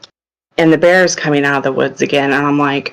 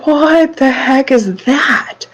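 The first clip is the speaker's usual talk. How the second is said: higher, slow, stunned question; startled disbelief; 9 semitones higher, slower than usual. Retold or imitated. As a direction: stare at it in disbelief and ask slowly. Shocked at goo from midsection.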